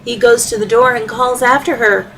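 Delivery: plain reading voice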